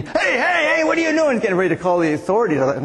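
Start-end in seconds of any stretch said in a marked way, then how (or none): 0.0-1.4 much higher, agitated protest; protesting ticket seller. Acted. Ticket seller objecting to a moved sign, high-pitched protest. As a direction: imitate an alarmed booth attendant calling out. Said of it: "the guy at the ticket booth was saying".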